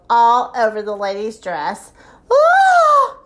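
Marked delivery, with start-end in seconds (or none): 2.3-3.2 high, loud drawn-out "Oh!"; startled cry. A narrator's reading. Lady drenched with ice water, startled high cry. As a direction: cry out in high-pitched shock. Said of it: "she cried".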